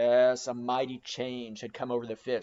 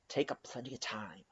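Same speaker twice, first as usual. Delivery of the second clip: mock calm aside; 5 semitones lower, 11 dB quieter than usual. A narrator's reading. Mate feigns calm to crew.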